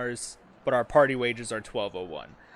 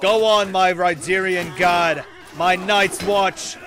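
rallying shout; 6 semitones higher, 7 dB louder than usual. Acted. Rallying his guards in battle.

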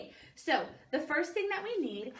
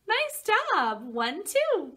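higher, louder cheering; excited praise; 8 semitones higher, 6 dB louder, slower than usual. Real, not acted. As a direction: cheer the little one on. Praising the child.

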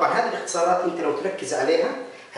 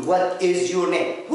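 full form, slow; much slower than usual. Shown as a demonstration. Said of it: "ما حدش بيقول".

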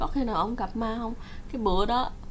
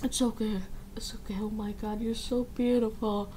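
dazed whisper; 9 dB quieter than usual. Retold or imitated. Stranger says it, dazed.

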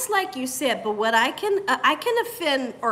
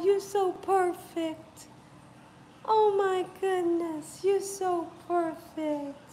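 tender cooing; 7 dB quieter, slower than usual. Real, not acted. Woman adoring a sweet baby, tenderly cooing. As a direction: coo softly, stretching the words out.

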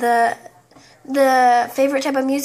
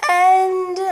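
drawn-out AND, higher and louder, voice slipping oddly; strange voice noise; 5 semitones higher, 5 dB louder than usual. Real, not acted. Player's voice does a strange noise mid-narration, odd held note. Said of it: "my voice did a strange noise".

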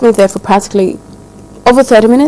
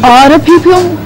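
high, loud incredulous "Other people?" rising up; shocked echo; 7 semitones higher, 5 dB louder than usual. Acted. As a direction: repeat "Other people?" in disbelief, higher and louder. Shocked at boyfriend's breakup request.

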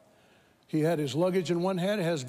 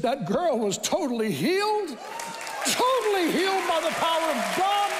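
fiery preacher shouting God's praise; preaching at full force; 12 semitones higher than usual. Real, not acted. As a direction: lift the church into hallelujah.

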